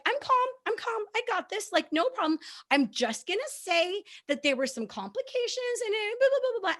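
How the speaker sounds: high, airy fake-calm voice; mock calm self. Nervous mom telling herself she's calm, high and airy. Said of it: "and I'm just like".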